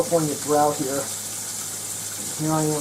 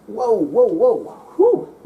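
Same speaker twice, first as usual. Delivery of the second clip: startled whoa; 7 semitones higher, 7 dB louder, slower than usual. Real, not acted. Hands in freezing ice cream.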